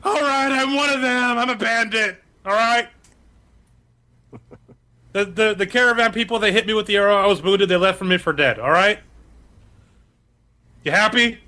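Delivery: high, whining bandit voice; panicked confession. Acted. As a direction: blurt it out like a cornered captive. Ronnie confesses at knifepoint.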